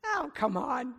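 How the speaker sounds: ordinary talk, nothing marked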